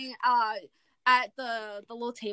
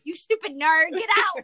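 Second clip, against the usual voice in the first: mean sister yelling; 5 semitones higher, 5 dB louder than usual. Retold or imitated. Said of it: "the girl goes".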